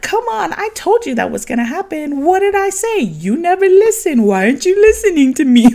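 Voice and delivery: high, loud mock nagging; playful nagging. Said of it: "saying everything in a playful way".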